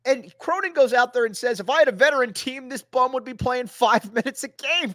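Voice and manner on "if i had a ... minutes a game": higher-pitched mock coach's complaint; retold exasperation. Retelling coach's press-conference complaint.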